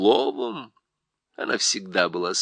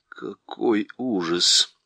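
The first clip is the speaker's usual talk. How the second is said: ordinary delivery, nothing marked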